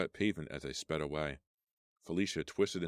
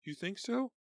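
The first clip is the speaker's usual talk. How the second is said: sarcastic. Acted. Sarcastic reply.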